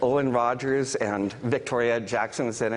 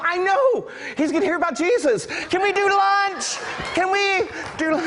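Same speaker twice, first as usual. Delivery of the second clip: high, loud, over-excited crowing; laughing, exuberant near-shout; gleeful excitement; 4 dB louder than usual. Retold or imitated. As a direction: call it out in hammed-up delight. Acts out gleeful reply to theft.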